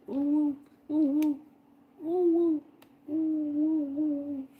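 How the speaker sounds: very high cooing ooh; high ooh noises. Retold or imitated. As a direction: coo like the pup. Imitating dog's ooh face.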